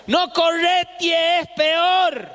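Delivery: put-on high, slow drawn-out old lady call; old women's warning. Old women sweeping warn the running boy, high and slow. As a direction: call it out like a neighborhood old woman. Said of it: "las viejas uñudas esas ... decían".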